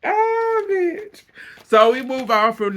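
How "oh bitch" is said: laughing exclamation. Real, not acted. Laughing reaction after gossip.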